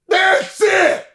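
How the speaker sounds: Farley answers the heckle before charging, shouting; mock threat. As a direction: yell it like a big guy accepting a dare. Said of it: "looks at me and goes".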